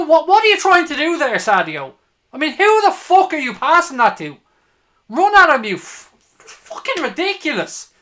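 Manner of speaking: ordinary talk, nothing marked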